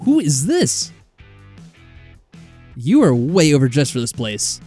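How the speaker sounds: ordinary delivery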